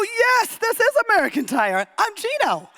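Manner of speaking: high, gushing self-quote; bubbling over with joy; excited. Retold or imitated. To the mechanic, giddy and excited. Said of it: "I'm, like, so excited".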